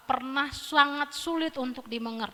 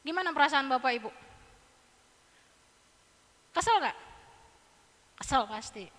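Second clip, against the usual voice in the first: ordinary delivery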